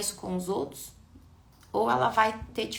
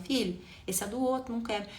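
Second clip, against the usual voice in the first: even, patient reminder; calm correction; 3 semitones higher than usual. Shown as a demonstration. The right way: parent calmly telling child not to break it. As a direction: say it gently, just explaining. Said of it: "Eu tô falando".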